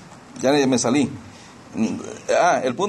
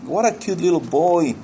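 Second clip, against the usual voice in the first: put-on slow, drawn-out cooing over a child; mock adoring coo; gushing admirers; 3 semitones higher, slower than usual. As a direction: imitate people fawning over a little boy. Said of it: "la gente que lo conoce, Ay".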